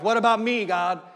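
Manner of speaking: put-out, me-first objection; self-centered complaint. Acted. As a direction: plead your own case indignantly. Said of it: "the idea is to get to the place".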